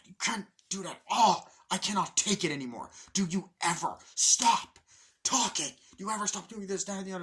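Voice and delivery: high, loud fed-up tirade; exasperated rant. Retold or imitated. Man cannot take roommate's noises anymore, loud exasperation. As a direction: lean hard on each word, at wit's end. Acted quarrel, man at breaking point.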